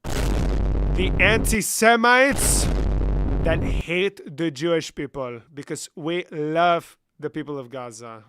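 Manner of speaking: slow, loud put-on Israeli accent, shouted on the anti-Semites; a first rough stab at an Israeli accent; mock Israeli accent attempt.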